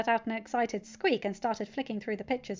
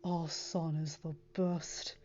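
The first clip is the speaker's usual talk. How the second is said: slow, hushed tender line; soft whisper; 4 semitones lower, 5 dB quieter, slower than usual. A narrator's reading. Father murmuring to his wife about their son, hushed. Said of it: "Tony whispered to Pepper".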